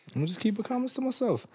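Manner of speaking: high-pitched, quick and soft; comic squeaky restraint. Pretend. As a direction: repeat it in a small, high comic voice. Comic repeat in a raised voice.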